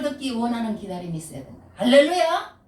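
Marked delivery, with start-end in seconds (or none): none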